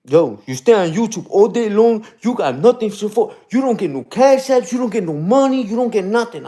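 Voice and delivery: mock a critic's rant, pitch climbing; mocking quote. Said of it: "he says, right?".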